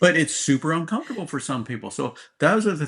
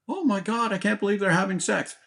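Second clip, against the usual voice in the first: mock gasping disbelief, pitched way up; a hammed-up, high-pitched parent in shock; 8 semitones higher than usual. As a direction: play the horrified parent who can't believe it.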